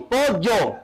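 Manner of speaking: "pollo" pronounced "po-yo". Shown as a demonstration. Teacher demonstrating the ye, "pollo" as "po-yo". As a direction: make the ll of "pollo" a plain y. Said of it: "La ye es así".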